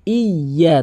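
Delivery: "ইয়া" slowly, y held double; doubled-letter pronunciation. Shown as a demonstration. Teacher demonstrating tashdid, slow and emphatic. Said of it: "তাসদিদের উচ্চারণ করতে হবে".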